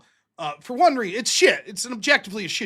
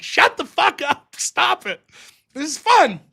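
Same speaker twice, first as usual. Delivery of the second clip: annoyed wife; 7 semitones higher, 4 dB louder than usual. Retold or imitated. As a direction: tell him off yet enjoy it. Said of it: "She'd be like".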